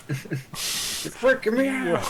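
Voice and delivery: high, drawn-out squeal through laughter; playful high-pitched panic; mock freak-out. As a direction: squeal it slowly, half laughing.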